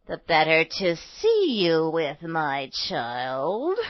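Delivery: low, slow put-on voice, lilting up at the end; wolf playing granny. A narrator's reading. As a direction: answer slowly as a disguised wolf. Wolf answers as grandmother.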